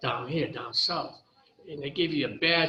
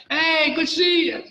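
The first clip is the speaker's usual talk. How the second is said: higher, louder, bright hello imitating the inmates; mock cheerful greeting; 11 semitones higher, 8 dB louder than usual. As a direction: call it out like an old friend spotting you. Inmates greeting him at jail.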